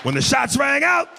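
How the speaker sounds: lightly voiced thug arguing in court; thug lawyer. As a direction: plead a case in mock street style. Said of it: "He'd be giving his closing argument".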